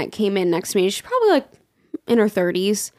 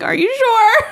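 high, whiny mock-anxious question; hammed-up nervous squeal; 14 semitones higher, 4 dB louder, slower than usual. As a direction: plead for reassurance, voice pitched way up. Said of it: "I just get nervous when people".

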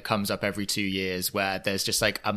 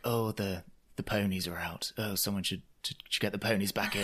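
soft, low, distracted murmur; Lisa; 3 semitones lower, 12 dB quieter than usual. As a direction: deflect quietly, as if suddenly preoccupied. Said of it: "then Lisa's like".

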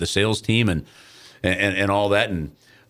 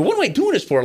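pitched way up, incredulous; exasperated outburst; 13 semitones higher, faster than usual. Retold or imitated. Calling Bruce after the rejection, high exasperated cry. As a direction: throw the question up in disbelief. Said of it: "And I go".

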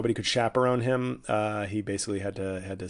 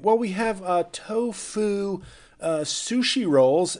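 high, snooty vendor voice; 8 semitones higher, 4 dB louder, slower than usual. Pretend.

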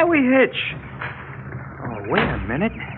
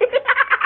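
real high-pitched laugh. Real, not acted. Teen laughing at the fooled kid, high laugh.

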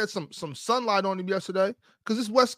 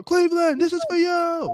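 imitated triumphant yell; 12 semitones higher than usual. Fan re-enacts a championship shout, imitated triumphant yell. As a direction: belt it out, stretching each word. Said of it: "Which one was better?".

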